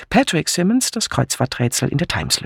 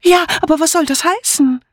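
sehr hohe, aufgeregte alte Dame; aufgeregt; 3 dB louder than usual. Acted. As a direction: frage ratlos mit hoher Kopfstimme. Amy aufgeregt über die Anzeige. (aufgeregt piepsend)

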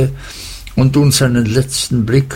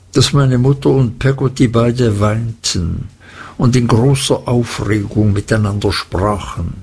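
usual speaking voice

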